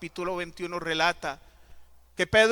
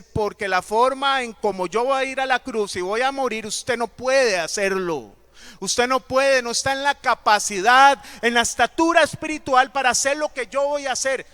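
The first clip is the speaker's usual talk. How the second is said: louder, higher emphatic Jesus voice; Jesus tells Peter firmly, raised and loud; firm rebuke; 6 semitones higher, 7 dB louder than usual. Acted. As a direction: press every word home, raised.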